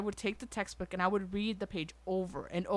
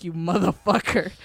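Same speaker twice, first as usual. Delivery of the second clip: loud, menacing mock curse; angry mom; 3 semitones lower, 9 dB louder than usual. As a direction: imitate a mother's furious glare in words. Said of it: "my mom's looking at me like".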